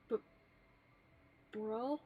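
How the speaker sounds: mild stammered bro, lightly voiced; a hint of a shy boy tripping on bro; stammering Haruto. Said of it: "Haruto would stammer".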